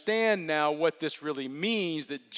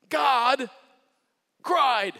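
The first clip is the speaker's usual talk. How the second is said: high, loud and slow on God cried; emphatic declaration; 8 semitones higher, 6 dB louder than usual. Real, not acted. Preacher proclaiming God cried to the congregation, emphatic. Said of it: "climax of the point".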